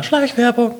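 high squeaky aside; high joke voice. Pretend. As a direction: squeak out the word as a gag. Joking aside about product placement.

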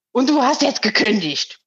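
lightly voiced mother calling from the balcony. Mother calls through the tilted window, curt mild call. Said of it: "da ruft die durch das gekippte Fenster".

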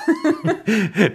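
high, amused laugh. Real, not acted. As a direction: break into laughter. Guest laughs at his own joke.

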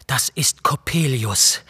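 a mild, understated cry of horror; horrified cry. A narrator's reading. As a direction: gasp the name in fear. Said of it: "rief ich entsetzt".

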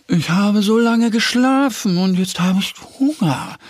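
a thin, hungry hedgehog complaining. Acted. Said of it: "sagt er".

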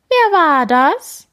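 high, loud, slow blaring call back; blared echo. A narrator's reading. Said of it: "schmettert es zurück".

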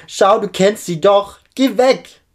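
high, loud, desperate yell. A narrator's reading. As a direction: shout them away, pitch jumping up. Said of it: "ruft Josephine lauter".

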